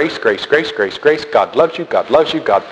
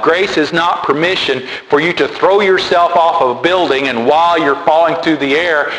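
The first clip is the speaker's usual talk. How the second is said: normal talking voice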